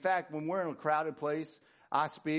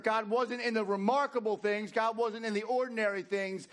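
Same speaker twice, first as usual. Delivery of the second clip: raised, driving sermon delivery; emphatic preaching; 8 semitones higher, 4 dB louder than usual. Acted. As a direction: preach it high and forceful. Preaches at full force.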